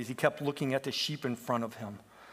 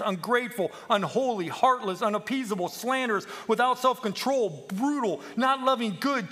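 raised voice, building list of sins; preached warning; 7 semitones higher, 4 dB louder than usual. Acted. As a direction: hammer out each vice as a warning. Peep recites a warning to the sheep.

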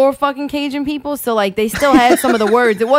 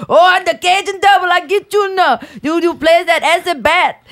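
Cajun Devil; 7 semitones higher than usual. Retold or imitated. Retelling the cartoon devil for friends, Cajun accent. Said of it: "the fucking Cajun Devil".

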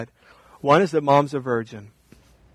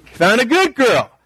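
lightly mocking, drawn-out smug voice; a touch of smug mock approval; mocking wrong view; 5 semitones higher, 3 dB louder, slower than usual. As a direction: play the naive reader, smug and slow. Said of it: "we don't wanna read this and say".

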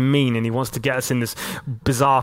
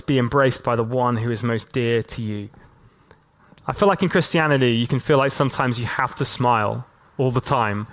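ordinary talk

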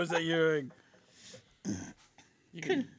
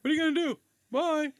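high, louder mocking thief; taunting; 11 semitones higher, 5 dB louder than usual. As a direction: taunt them and leave.

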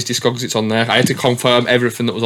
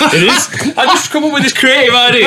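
laughing; 5 dB louder than usual. Real, not acted. Laughing at the worst podcast.

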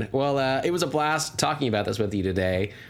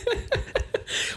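airy unvoiced laugh; breathy laugh; 3 dB quieter than usual. Real, not acted. Laugh after squeaky plea.

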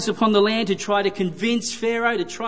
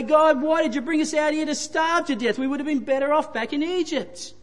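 higher, whiny voice of complaining Israelites; mock whinging complaint; 6 semitones higher than usual. Preacher voicing hungry Israelites complaining to God, whiny and high. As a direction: whinge at God like a starving crowd. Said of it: "They begin to grumble and whinge and complain".

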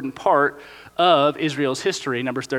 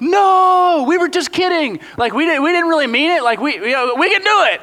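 much higher, louder backpedaling; frantic Israelites; 11 semitones higher, 5 dB louder than usual. Pretend. The panicked nation insisting it was just kidding, high and loud. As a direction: scramble to take it back, frantic. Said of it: "They freak out".

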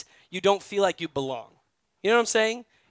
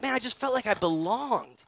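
Tyler's voice; 4 semitones higher than usual. Retold or imitated. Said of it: "he's like".

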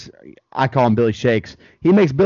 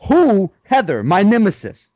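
put-on dramatic kid; 6 semitones higher, 4 dB louder than usual. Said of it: "And Corey goes".

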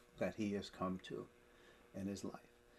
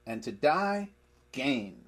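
DIE high and loud, pause, then GAIN firmly alone; emphatic; 3 semitones higher, 6 dB louder, slower than usual. Shown as a demonstration. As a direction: lift DIE hard, stop, land on GAIN. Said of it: "He is emphatic as he is saying this".

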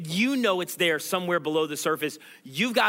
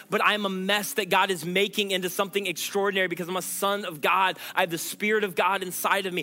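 ordinary talk

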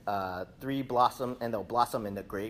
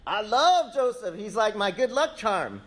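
Potiphar gushing; 9 semitones higher, 6 dB louder than usual. Retold or imitated. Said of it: "So Potiphar's like".

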